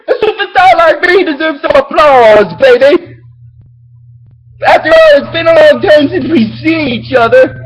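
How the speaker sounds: hammed-up superstar villain; Etemon swagger. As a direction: strut through it like a vain rock star. Etemon quoted showing off.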